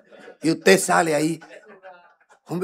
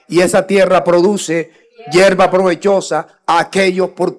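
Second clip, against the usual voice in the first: preacher thundering the scripture to his flock; full-force preaching; 4 semitones higher, 8 dB louder than usual. Acted. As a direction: proclaim the verse to the congregation.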